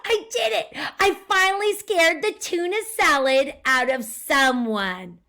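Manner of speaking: high, loud gleeful shout; triumphant cheer. Acted. Monster gloating after scaring Sam, triumphant. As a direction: crow over the victory. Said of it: "cheered Leonardo".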